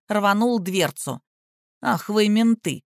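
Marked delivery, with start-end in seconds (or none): none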